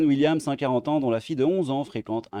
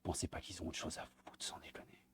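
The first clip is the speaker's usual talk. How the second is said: whisper; 6 semitones lower, 18 dB quieter than usual. Real, not acted. Exasperated aside to viewers.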